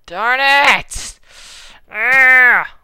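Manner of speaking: loud, high angry outburst; frustrated yell. Real, not acted. Frustrated at game failure.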